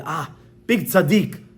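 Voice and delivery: slow, drawn-out exclamation of praise; mock admiration. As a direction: gush slowly as if hugely impressed. Outsiders admire the charitable man.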